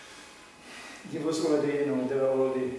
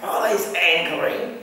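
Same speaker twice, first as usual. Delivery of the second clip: louder, very slow angry grumble; mock angry growl; 3 semitones higher, 7 dB louder, much slower than usual. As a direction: drag it out grumpily. Mimicking angry mood.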